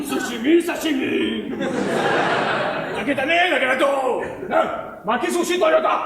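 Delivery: hammed-up Japanese accent, shouting; fake Japanese. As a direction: fake Japanese gibberish, high and loud. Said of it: "Pourquoi on parle japonais?".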